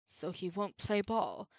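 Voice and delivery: soft, slow, low-pitched brooding read; low character voice. Acted. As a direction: murmur it like a cool prosecutor. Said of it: "you can read it normally to preserve your voice".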